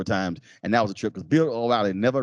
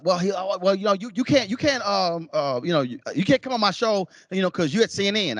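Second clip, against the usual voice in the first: flustered, stammering excuses; a hint of a caught liar fumbling words; stuttering flustered host; 9 semitones higher than usual. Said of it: "Sean starts stuttering".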